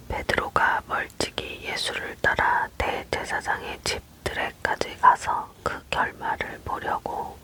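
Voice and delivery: usual speaking voice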